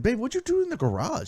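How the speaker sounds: husband quizzing his wife about the wrecked garage, accusing; accusing himself. Retold or imitated. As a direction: play his past self blaming her. Acted quarrel with Nora.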